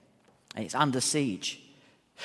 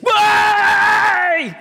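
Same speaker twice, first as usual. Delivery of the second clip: loud, high whoop of joy; joyful shout; 11 dB louder than usual. Retold or imitated. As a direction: yell it like a fan pouring out of the pub. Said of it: "suddenly I heard this".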